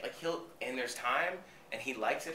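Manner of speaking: ordinary delivery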